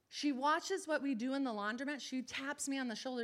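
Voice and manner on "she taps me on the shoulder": ordinary talk, nothing marked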